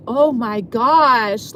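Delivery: loud, drawn-out exasperated exclamation; dramatic. Retold or imitated. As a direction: stretch it out slowly, making a big scene. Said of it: "just being kinda dramatic about it".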